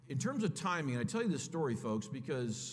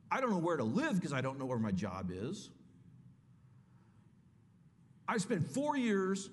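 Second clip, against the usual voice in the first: arguing with God; 4 semitones higher than usual. Retold or imitated. Young man pleading with God at the beach, exasperated. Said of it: "I had the grandest argument with God".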